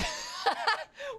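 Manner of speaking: giggling at herself, high and bright; high laugh. Real, not acted. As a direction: burst out laughing at the blunder. Laughing at her own mishap.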